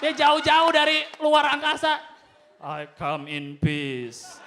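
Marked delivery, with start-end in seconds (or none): 2.6-4.4 very slow, low alien voice; deep slow alien announcement; solemn alien. Acted. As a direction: drag it out like a visiting alien.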